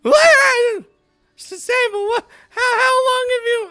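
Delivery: very high, loud shriek of surprise; startled roommate. Acted. Tix caught by the roommate approaching, startled squeal. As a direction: squeal and stammer as if caught off guard.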